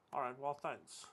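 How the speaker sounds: ordinary talk, nothing marked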